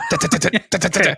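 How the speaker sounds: high rapid drumming da-da-da; imitated drum taps. Mimicking a tiny drummer stuck in a cupboard, high mock drumming. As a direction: tap it out like a trapped tiny drummer. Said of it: "tiny marching band drummer was trapped".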